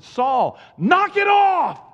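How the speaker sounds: high, slow shout of command; booming rebuke. Acted. God rebuking Saul from heaven, slow booming shout. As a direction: thunder it down like God stopping him.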